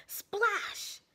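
usual speaking voice

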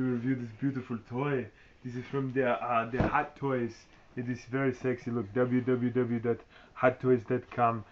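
put-on thick Austrian Arnold accent throughout; Arnold impression. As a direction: play Arnold Schwarzenegger reviewing his own action figure. Speaker plays Arnold reviewing a toy.

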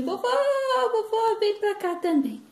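put-on loud, high child's call; calling granddaughter. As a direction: holler for Grandma to join in. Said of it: "Aí a Cá falou assim".

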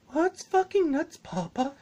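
put-on high, slow childish voice; a kid asking his dad, childlike; mock child. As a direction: play the little kid asking.

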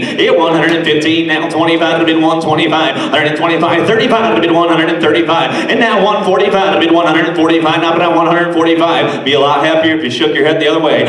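rapid rhythmic auction chant; fast sing-song bid calling; auctioneer. Acted.

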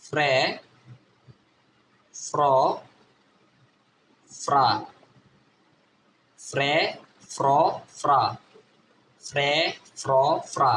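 ফ্রে, ফ্র, ফ্রা as separate blended FR syllables; phonics drill. Shown as a demonstration. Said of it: "কি হবে?".

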